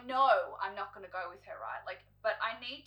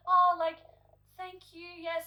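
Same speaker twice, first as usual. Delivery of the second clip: high, sugary mock gratitude; fake polite thanks; 9 semitones higher, 9 dB louder, slower than usual. Mock grateful to the hairdresser, high and sweet. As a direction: put on a bright fake thank you.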